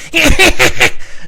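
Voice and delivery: loud, very high fake cackle; exaggerated shrieky pulsing laugh; mocking laugh imitation. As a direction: mimic someone's silly laugh, squealing. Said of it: "making fun of my laugh".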